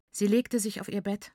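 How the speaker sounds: plain reading voice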